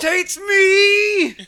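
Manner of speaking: high, slow, stretched squeal of delight; excited squeal. Real, not acted. High squealed shout praising a movie he loves. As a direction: shout it like the movie lifts you.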